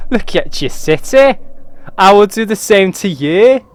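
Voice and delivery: hammed-up villain raven in Scottish accent; menacing raven accent. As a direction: boom down at the human in a bad Scottish accent. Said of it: "the worst Scottish and Northern accents".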